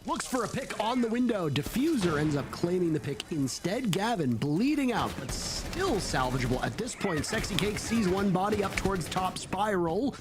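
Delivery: live esports call, pitch climbing to the climax; excited play-by-play. Real, not acted. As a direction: drive the round to its finish.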